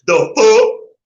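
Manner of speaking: hammed-up, blurted street cuss; mock cussing slang. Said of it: "You gotta cuss every damn five minutes".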